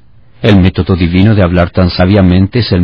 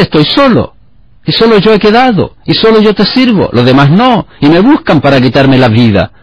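much higher, whining self-pity, mildly hammed; self-pitying whine; 8 semitones higher than usual. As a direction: whimper out how alone you are, pitched way up. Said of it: "Es nada más que autocompasión". (Elías lloriqueando con autocompasión, en tono mucho más agudo)

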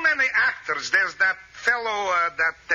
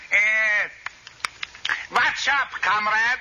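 imitating Bugs Bunny; slower than usual. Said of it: "The way he says".

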